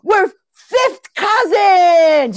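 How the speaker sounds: high, loud, slow joyful cry; excited shout. Retold or imitated. New Italian acquaintances declaring themselves family, shouting joyfully. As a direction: shout it out in delight.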